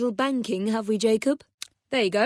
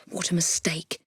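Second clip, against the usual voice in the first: whispered sigh; 5 semitones lower, 7 dB quieter than usual. Acted. Sighing aside in mission.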